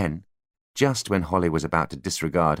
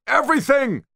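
much higher, loud scream; 5 dB louder than usual. A narrator's reading. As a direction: scream it at the top of lungs. Spyro's peak shout.

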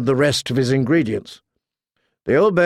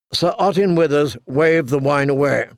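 plain reading voice

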